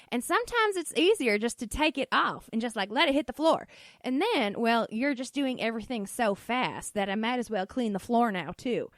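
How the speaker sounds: put-on rambling Southern-accented mom. Mom explains doing dishes in a robe, rambling Southern accent. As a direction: imitate mom justifying herself in a Southern twang. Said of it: "this weird accent that I'm choosing".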